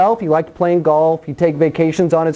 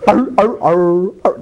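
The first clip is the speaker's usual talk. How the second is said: growly chomping arr noises while eating; munch it out like a caveman chewing fruit; Adam's eating sound. Retold or imitated. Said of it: "That's his eating sound".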